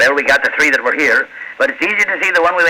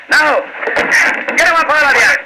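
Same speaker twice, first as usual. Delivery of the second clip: shouted command; 9 semitones higher than usual. Acted. Sergeant bursting in on the crooks, shouting. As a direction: bark it as you burst through the door.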